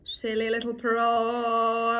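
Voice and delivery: slow, stretched-out teasing; mock-scolding singsong, very slow; playful echo. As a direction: draw every word right out. Drawn-out echo of friend's teasing.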